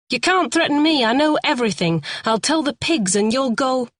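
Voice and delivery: high, pushy defiance; defiant. A narrator's reading. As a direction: push back hard and high. Lee defies Terry.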